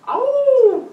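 high, loud wolf howl. A narrator's reading. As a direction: howl at full voice. Wolf howls in chase. (hurlement de loup aigu)

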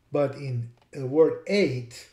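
usual speaking voice

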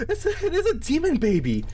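high, laughing disbelief. Real, not acted. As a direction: laugh through the words. Laughing at the baby.

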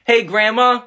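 ordinary delivery

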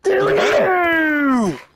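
high, slow victory yell; triumphant shout. Real, not acted. Celebratory catchphrase again.

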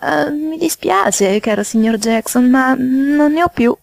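high, drawn-out polite apology; high-pitched, awkward excuse; hiding embarrassment. A narrator's reading. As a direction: stretch it out high to cover embarrassment. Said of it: "non far trasparire il suo imbarazzo".

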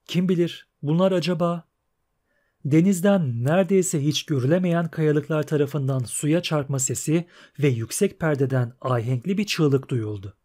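usual speaking voice

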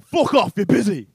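loud, high outraged yell; angry shout. Acted. Drug dealer to suspected police caller, furious shout. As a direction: explode and send the caller packing.

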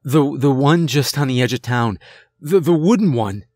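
panicked half-shout; almost shouting. Acted. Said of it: "I was almost shouting at this point".

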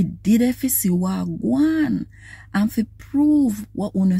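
lively, expressive Bible reading in Patois; scripture reading. Acted. Reading Jesus' teachings to morning channel viewers, expressive scripture reading. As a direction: read the Gospel with preacher's feeling, pitch rising and falling. Reads Matthew scripture aloud.

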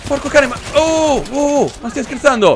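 high, incredulous outburst; startled disbelief. Real, not acted. Player suddenly finds enemies inside, startled disbelief. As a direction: protest at a sudden ambush.

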